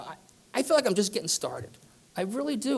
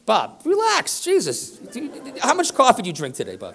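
teasing Bob; 8 semitones higher, 4 dB louder than usual. Real, not acted. Teasing an audience member who stood.

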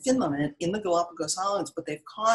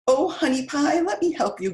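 doting grandpa; 8 semitones higher, 4 dB louder than usual. A narrator's reading. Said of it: "Grandpa Jones said".

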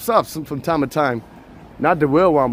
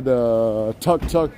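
ordinary talk, nothing marked

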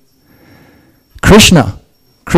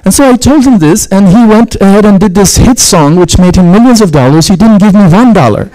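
amused; 9 semitones higher than usual. Retold or imitated. Retelling story, amused, pitched up.

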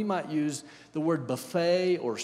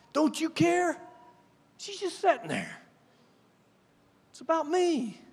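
much higher, pleading whine; whiny Martha; 13 semitones higher, 4 dB louder than usual. Acted. Martha pleads with Jesus, high and whiny. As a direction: whine like the overworked hostess.

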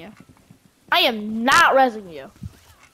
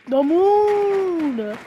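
mock exaggerated quote; 4 semitones higher than usual. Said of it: "He's like".

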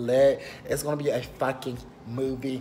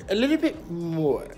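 higher, put-on DC accent, hammed up; 4 semitones higher than usual. Vlogger showing off his home DC accent, playful DC accent. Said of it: "That's my D C accent".

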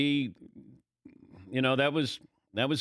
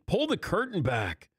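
normal talking voice

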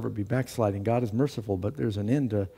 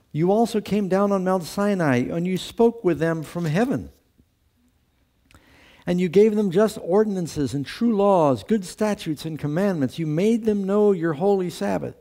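raised, louder preacher's scripture read; 7 semitones higher, 3 dB louder than usual. Acted. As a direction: proclaim the verses, pitched up. Reads Nehemiah aloud.

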